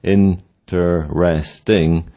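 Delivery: "interesting" in four separate beats; split into syllables. Shown as a demonstration. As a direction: stop after each syllable of "interesting". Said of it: "you can stop several times while".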